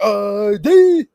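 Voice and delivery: loud, drawn-out drill sergeant bellow; mock parade-ground command; boot camp sergeant. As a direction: bark it like a boot camp instructor. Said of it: "a boot camp scene or something".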